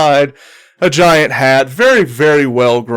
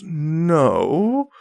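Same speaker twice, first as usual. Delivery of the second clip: long, drawn-out no, dipping then rising; a higher, questioning drawl on one word; Galma; 4 semitones higher, 4 dB quieter than usual. Acted. Galma answering the captain's assumption, drawn-out doubtful no. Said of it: "she looks over and she goes".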